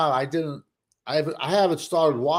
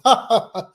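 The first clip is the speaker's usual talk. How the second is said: mock laugh; 8 semitones higher, 4 dB louder than usual. Retold friends laughing at him.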